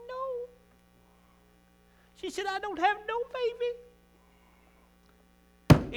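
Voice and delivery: high, weepy voice choked with tears; sobbing reply. Acted. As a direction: say it through sobs, barely getting words out. Hannah answers sobbing at altar.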